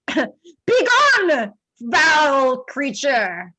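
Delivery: loud, high cry of banishment; druid's shouted banishment, slow and dramatic; theatrical scream. Acted. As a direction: scream it out, dragging out the last words. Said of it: "she's going to scream at him".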